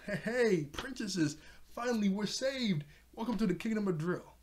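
high-pitched, delighted mock welcome; excited greeting. Traptor welcoming the princesses to the kingdom, excitedly. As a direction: greet them like rescuers have arrived.

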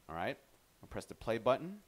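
normal talking voice

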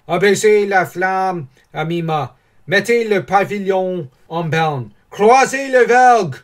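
high, loud commands building in force; shouted orders. Acted. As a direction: call out orders to a ship's crew. Ordres de manœuvre criés à l'équipage. (ordres criés à l'équipage, de plus en plus fort)

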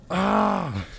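high, loud scream of fright; startled scream. Real, not acted. Player caught by a scare in a horror game, startled scream. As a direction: scream out in sudden shock.

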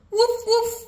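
high, loud yappy puppy barks. Acted. Reader barking as Biscuit the puppy, high and loud.

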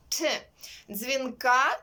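ordinary talk, nothing marked

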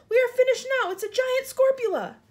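very high, loud wail of doom; panicked yell. A narrator's reading. Fire chief facing a giant spider, yelling. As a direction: cry out that all is lost. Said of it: "yelled the head of the fire department".

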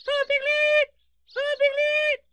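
extremely high, loud little Roo; tiny piercing squeal; excited squeak. Acted. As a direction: shriek the name with excitement. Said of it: "heard him and squeaked".

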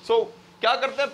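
ordinary talk, nothing marked

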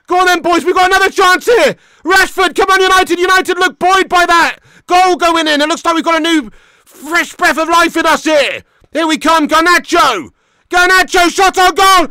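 high loud urgent commentary; real fan commentary; excited live call. Real, not acted. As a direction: call the attack as it builds. Live urging, attack.

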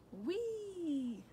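long high whee, airplane play; gleeful cry. A narrator's reading. Danny playing airplane in the yard, gleeful cry. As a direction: squeal it out high like a child at play. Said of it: "he cried".

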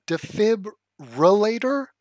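"Defibrillator" spelled out slowly, syllable by syllable, rising; careful pronunciation. Shown as a demonstration. Practising a hard word aloud, slow careful sounding-out. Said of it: "I need to pronounce that".

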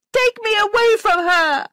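very high, loud shriek of demand; angry child's shout. A narrator's reading. Flora begging Missus Grose to take her away, angry shout. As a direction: cry out for rescue at full pitch. Child turns against governess.

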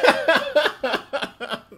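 high, loud laugh; excited laughter. Real, not acted. Streamer laughing at the new sponsor, excited laughter. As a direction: laugh with delight.

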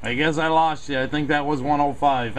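ordinary talk

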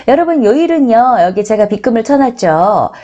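ordinary talk, nothing marked

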